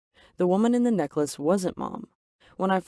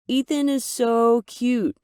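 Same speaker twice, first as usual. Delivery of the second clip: higher, slow gushing mom voice; doting mom; 6 semitones higher, 4 dB louder, slower than usual. Acted. Mom gushing to daughter about Ethan, high and slow. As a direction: mom cooing over the visitor, slow and higher.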